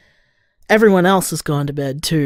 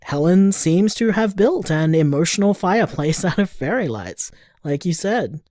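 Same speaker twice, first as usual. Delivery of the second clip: ordinary delivery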